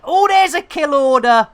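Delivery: excited spotting. Real, not acted. Streamer spots a kill order in game, excited exclamation. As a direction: pipe up as you spot it.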